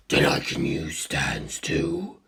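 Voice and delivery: low, slow whispered monster voice; cold ancient being. A narrator's reading. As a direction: breathe the question in calm interest. Cars voice.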